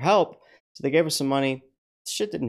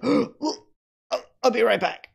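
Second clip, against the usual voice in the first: higher, mock-urgent exit line; sudden queasy urgency; 11 semitones higher than usual. Patient excusing herself to go throw up, mock queasy urgency. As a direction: imitate someone suddenly about to throw up. Acts out patient about to vomit.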